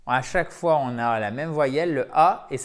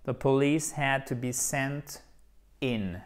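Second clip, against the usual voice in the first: "The police had to be sent in" read slowly; slow model sentence. Shown as a demonstration. (phrase modèle lente)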